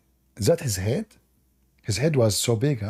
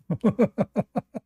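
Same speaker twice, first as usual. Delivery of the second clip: laugh; 8 semitones higher than usual. Real, not acted. Laugh after pleading.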